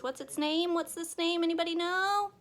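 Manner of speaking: high singsong questioning; high-pitched kindergarten teacher asking; teacher quizzing kids. Acted. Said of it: "and I'll go".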